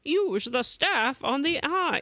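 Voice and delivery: high insistent repeat of the order; urgent old man. Retold or imitated. Old man repeats the order, high and insistent. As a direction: nag like a fussy old mentor.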